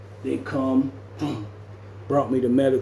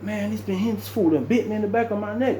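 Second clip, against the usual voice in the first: higher, indignant complaint; incredulous at being bitten; retold outrage; 8 semitones higher than usual. Telling roommate he bit my neck, higher. Quoting own outrage after bite.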